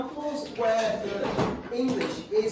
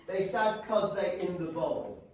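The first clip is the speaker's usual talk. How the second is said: slow reading aloud. Shown as a demonstration. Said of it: "teacher reads dialect sentence slowly".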